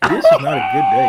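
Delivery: high laughing cry of despair; laughing exclamation. Real, not acted. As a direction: burst out laughing at your own bad luck. Bald, bandaged, exclaiming.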